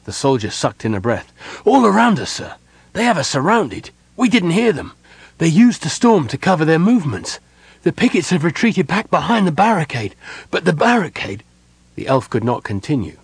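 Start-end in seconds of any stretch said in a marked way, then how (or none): 1.6-11.4 high, loud, urgent report; breathless alarm. A narrator's reading. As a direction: runner pouring out bad news to his commander. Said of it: "He was out of breath".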